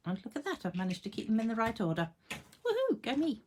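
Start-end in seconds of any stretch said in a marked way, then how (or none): 2.3-3.4 self-cheer. Real, not acted. Self-congratulation.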